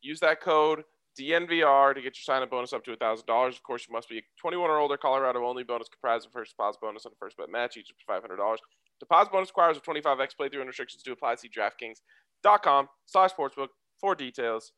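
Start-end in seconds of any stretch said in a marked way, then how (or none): 3.6-14.7 fast legal disclaimer. Acted.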